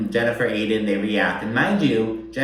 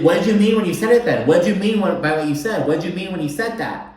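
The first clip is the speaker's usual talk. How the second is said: imitated demand, higher and louder; raised voice; 7 semitones higher, 4 dB louder, faster than usual. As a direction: retell her pressing the question, voice raised. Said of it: "she kind of raises her voice a little bit".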